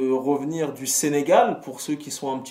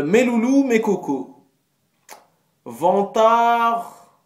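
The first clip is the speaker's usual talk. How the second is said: hyped announcer call; 7 semitones higher, 6 dB louder, much slower than usual. Acted. Hyped self-address to fans.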